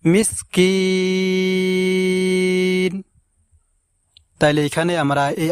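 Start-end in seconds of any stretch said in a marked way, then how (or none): 0.1-3.0 মিসকিন with the last কিন drawn out slowly; slow recitation drawl on মিসকিন; long final vowel. Shown as a demonstration. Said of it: "লম্বা উচ্চারণে টান দিয়ে পড়ব এইভাবে".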